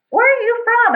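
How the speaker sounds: high-pitched mock friendly question; imitating older lady. Race volunteer quizzing a runner at the tunnel, high mock-curious.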